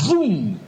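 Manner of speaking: high, sudden boom that falls away; imitated burst of impact; explosive boom. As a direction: fire it off like a sound effect. Imitates sudden burst onto stage.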